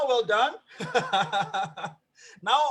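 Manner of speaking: ordinary talk, nothing marked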